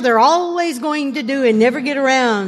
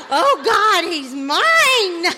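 gleeful gloat; 5 semitones higher, much slower than usual. Retold or imitated. Gloating after husband asks tow.

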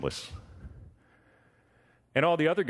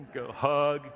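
normal talking voice

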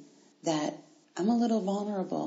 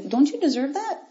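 usual speaking voice

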